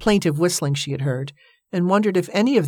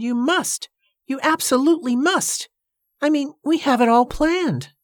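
high-pitched, urgent pleading; dramatic acted entreaty; wheedling; 10 semitones higher than usual. A narrator's reading. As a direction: beg him, swooping up on key words. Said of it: "You're wheedling".

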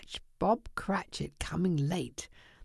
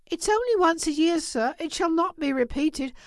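higher-pitched, apologetic clerk; anxious pleading; 8 semitones higher than usual. Acted. Clerk pleading with his boss.

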